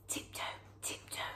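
fast hushed whisper. Acted. Fleeing the bear, whispering quickly. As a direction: hurry but keep it hushed. Sneaking back.

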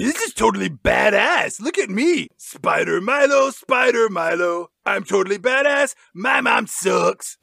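boastful Milo. Acted. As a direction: show off like an overexcited kid hero. Playing Milo showing off.

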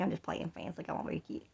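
soft, lightly voiced toddler; voicing baby. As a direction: speak for the baby coyly. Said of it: "She's like".

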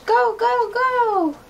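high, loud urging go go go; excited cheer. Acted. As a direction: cheer the ostrich on. Cheering the running ostrich.

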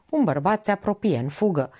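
plain reading voice

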